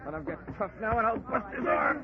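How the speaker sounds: a man pinning a thug, high and strained; straining in a fight. Acted. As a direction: talk through the effort of a hammerlock. Johnny grappling in fight.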